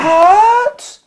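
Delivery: high, loud shocked yelp; a room full of people caught off guard; shocked what. Retold or imitated. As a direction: yelp it out in disbelief. Whole room gasps in shock.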